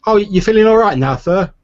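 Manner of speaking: put-on high, loud timid boy voice; young stable boy. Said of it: "he looks up at you and he says".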